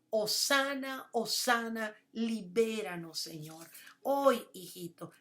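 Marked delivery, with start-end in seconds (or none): 0.1-3.7 slow drawn-out Hosanna plea; mild mock crowd cry. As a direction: call out slowly like a crowd begging for rescue. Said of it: "y están gritando".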